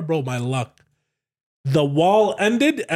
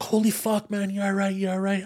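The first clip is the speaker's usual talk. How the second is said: higher, hushed panicky check-in; freaked-out bystander; 5 semitones higher, 4 dB quieter than usual. Retold or imitated. Cop checking on a crashed driver, freaking out. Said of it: "he's freaking out".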